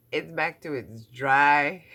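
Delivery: loud, slow, drawn-out moan; exaggerated complaint. Real, not acted. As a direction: wail it out half laughing. Laughing complaint about dry skin.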